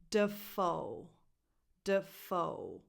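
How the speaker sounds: "Dafoe" with a weak first syllable and a stressed FOE; careful "Dafoe", duh-FOE; second-syllable stress. Shown as a demonstration. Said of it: "The stress goes on the second syllable".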